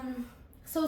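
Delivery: usual speaking voice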